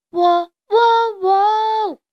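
high, loud cock-a-doodle crow; long crowing notes, high and loud; rooster crowing. A narrator's reading. Little rooster practising his singing from the haystack, exuberant crowing. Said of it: "卡梅利多越喊越起劲".

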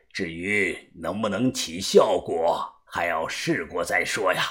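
put-on low, soft old master explaining; unhurried, quiet, low elderly voice; old fortune-teller. Old diviner explains his secret method to companions, old fortune-teller.

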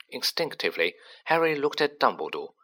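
usual speaking voice

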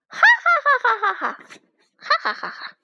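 high, loud, rapid cackle dying away; staccato gloating bandit cackle; weird villain laugh. A narrator's reading. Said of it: "强盗巴巴怪声笑道". (强盗怪声狂笑)

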